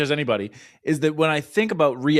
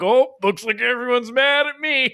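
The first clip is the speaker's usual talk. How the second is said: high mocking sheepish voice; mock sheepish CEO; 10 semitones higher than usual. Podcaster mocks the Red Sox CEO's non-apology, high and mocking. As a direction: ham up a shrugging executive. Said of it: "be like".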